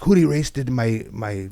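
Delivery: high, loud imitation of a teacher's bad Spanish accent; mock an outraged teacher with a clumsy accent; high-pitched, indignant teacher imitation, accent exaggerated. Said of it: "with her stupid ass accent".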